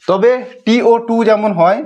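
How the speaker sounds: ordinary delivery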